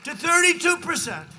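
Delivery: loud, rising shout over the crowd; triumphant rally shout; excited shout. Real, not acted. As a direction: call out the wins to the rally. Rally crowd cheering the results.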